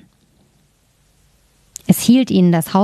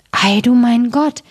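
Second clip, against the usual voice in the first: amazed cry; 4 semitones higher than usual. Acted. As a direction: gasp it out in awe at the sleeping girl. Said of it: "riefen sie".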